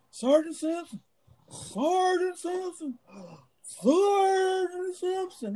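high, drawn-out frantic cries of a name; desperate high-pitched yell across the hall; panicked yelling. Retold or imitated. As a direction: call for the sergeant in pure panic, voice cracking. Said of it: "panic, panic mode".

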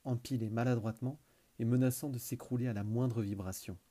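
usual speaking voice

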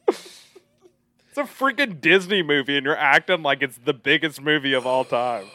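hammed-up exasperated tirade, easing slightly at the end; mock anguished protest. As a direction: rail at him in playful outrage. Mock tirade at the actor Denzel.

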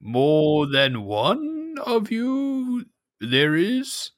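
slow, odd villager greeting; cave dweller voice. Acted. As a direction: greet the stranger warily. GM voices Tunach.

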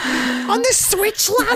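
very high, slow squeaked mock of a mate; squeaky mock northern lad. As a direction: drag it out high and silly like a Yorkshire lad. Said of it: "and I'm just like".

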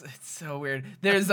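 ordinary talk

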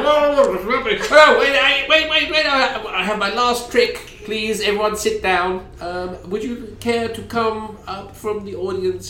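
high, frantic stage magician; flustered showman begging for calm; panicky magician. Acted. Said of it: "He's like".

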